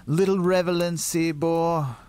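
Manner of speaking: usual speaking voice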